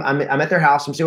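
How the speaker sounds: ordinary talk, nothing marked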